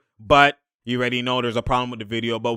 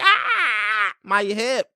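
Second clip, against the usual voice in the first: mock pained Rondo; 8 semitones higher, much slower than usual. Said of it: "And Rondo's like".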